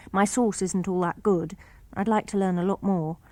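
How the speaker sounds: plain reading voice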